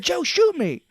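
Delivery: over-the-top shoot-me plea; hammed-up desperate plea. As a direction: shriek the plea bigger. Repeat, bigger.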